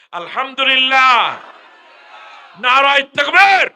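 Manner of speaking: very loud, high, slow chanted slogan; crowd roar. Real, not acted. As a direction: shout it all together at full force. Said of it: "দুই group মিললে বলেন না". (সবাই মিলে চিৎকার করে)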